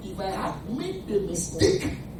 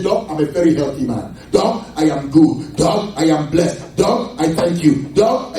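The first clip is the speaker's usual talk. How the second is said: louder, slow declarations to the doctor; bold confident talk; 9 dB louder than usual. Retold or imitated. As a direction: proclaim your health to the doctor. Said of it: "that's how I talk to them".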